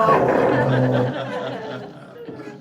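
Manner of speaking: long, loud laugh; big real laugh that carries on; loud laughter. Real, not acted. Group reacts to God part.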